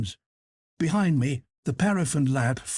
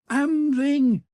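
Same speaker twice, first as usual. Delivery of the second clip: high, drawn-out call; 11 semitones higher, 4 dB louder than usual. A narrator's reading. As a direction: cry the name out high, stretching it. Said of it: "he cried".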